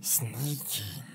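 soft hissed one word; sly Gollum. Acted. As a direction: Gollum the creature answering slyly. Gollum answers.

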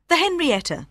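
usual speaking voice

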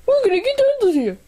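high, fast excited squeak; high squeaky voice. Acted. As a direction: squeak it out quickly. Squeaky prisoner voice.

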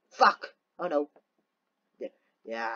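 ordinary talk

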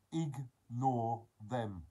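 "ignore them" broken into slow separate syllables; slow and emphatic. Real, not acted. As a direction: stretch out "ignore them" piece by piece. Spells out advice syllable by syllable.

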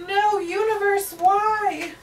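high pleading wail to the universe; dramatic plea. Real, not acted. Spotting duplicate pages, begging the universe in high dramatic despair. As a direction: cry out to the heavens in mock despair. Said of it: "maybe I'm being dramatic".

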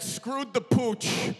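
slow drawn-out Midwest phrase; minnesota accent. Retold or imitated. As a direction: stretch it like a Minnesotan.

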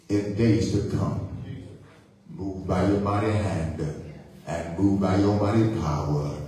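low, slow, earnest pulpit prayer; solemn prayer. Real, not acted. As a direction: pray deliberately, pausing between petitions. Leads a prayer.

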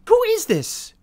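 stunned by a stranger's gift; amazed. Real, not acted.

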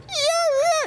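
very high, loud screech; imitated tire squeal. Car gets nailed out of the dealership, imitated screech. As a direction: squeal like tires peeling out. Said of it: "The car goes".

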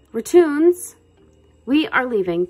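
high, loud first word easing off; mild megaphone shout. A narrator's reading. Cousin rousing the others to leave, mildly shouted. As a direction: call it out as if through a megaphone. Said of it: "Trap shouted through the megaphone".

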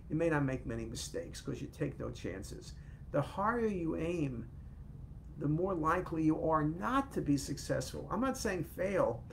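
normal talking voice

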